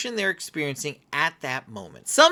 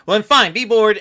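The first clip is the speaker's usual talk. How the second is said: louder exasperated snap; frustrated; 5 semitones higher, 9 dB louder than usual. Retold or imitated. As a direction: give up on the kid in exasperation. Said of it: "And then we get frustrated".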